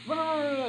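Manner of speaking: high, soft mock growl; little high-pitched territorial "grrr"; imitated butterfly growl. Butterfly warns off another butterfly, mock growl.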